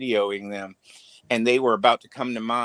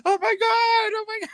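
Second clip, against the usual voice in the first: much higher, shrill-free mock shock; shocked gasp. Imitating the caught woman's horrified oh my god, much higher. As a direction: play her horrified at being caught out.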